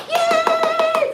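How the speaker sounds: very high, loud shrieking yay; loud cheer. Retold or imitated. Class cheering a student's audition, very high loud yay. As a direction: cheer like the crowd has gone wild.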